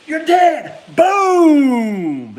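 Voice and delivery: high, loud yell with a boom; loud, high mock gunshot shout; prank shout. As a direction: shout it like you're scaring him. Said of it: "and I'm like".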